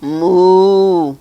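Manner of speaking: loud, long drawn-out moo; cow moo. Acted. Duck with the cow's sound, loud comic moo. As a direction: give a big hearty moo. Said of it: "said the duck".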